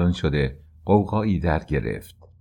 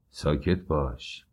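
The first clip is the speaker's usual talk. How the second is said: ordinary delivery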